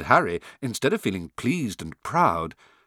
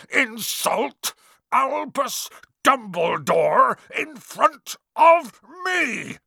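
slow, high, furious shout; thundered; 12 semitones higher, 5 dB louder, much slower than usual. A narrator's reading. Hagrid enraged at insult to Dumbledore, thundering. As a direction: roar it out word by word. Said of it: "he thundered".

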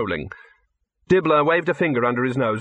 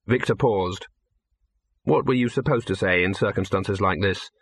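ordinary delivery

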